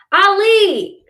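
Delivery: high, loud screeched name; screeching. A narrator's reading. Little sister calls her brother to dinner, screeching. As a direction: screech his name through the door. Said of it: "Shireen's big mouth screeches".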